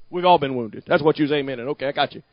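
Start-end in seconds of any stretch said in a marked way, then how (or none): none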